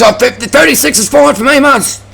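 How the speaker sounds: squeaky high mock of a know-it-all rider; mocking high whiny voice. Mocking the rider from Norton Summit in a squeaky high voice. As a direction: pitch it way up like a smug cyclist. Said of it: "He goes".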